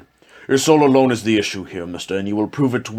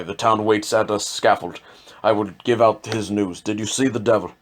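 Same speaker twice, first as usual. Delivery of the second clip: ordinary talk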